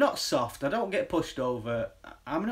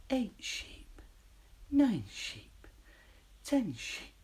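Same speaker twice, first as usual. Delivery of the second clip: whispered sheep count; drowsy counting sheep; 6 semitones higher, 6 dB quieter, much slower than usual. Pretend. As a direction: lull them with counting. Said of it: "the countdown for sheep".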